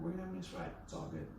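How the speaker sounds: hushed reassurance; calm monotone. Retold or imitated. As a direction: keep it easy so nobody panics. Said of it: "me being so monotone, relaxed, and calm".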